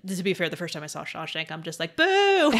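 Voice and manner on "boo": a loud, high mock boo at the film. Jeering the Shawshank ending with a loud mock boo. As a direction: boo it loudly like a jeering fan.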